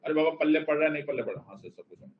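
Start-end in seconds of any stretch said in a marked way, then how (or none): 1.4-2.1 mock student mumble. Teacher voices students' reply.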